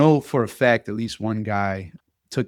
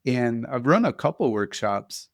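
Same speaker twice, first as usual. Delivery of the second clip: ordinary talk, nothing marked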